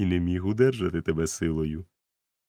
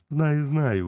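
higher, louder exclaim; 7 semitones higher, 4 dB louder than usual. A narrator's reading. Doctor exclaims to Jim, a bit higher and louder. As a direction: blurt it out eagerly. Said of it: "вигукнув він".

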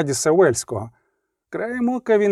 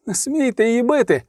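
high-pitched cry of protest; acted protest, pitched well up; 7 semitones higher than usual. A narrator's reading. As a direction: cry out with raised pitch, little extra volume. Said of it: "закричав Рейневан".

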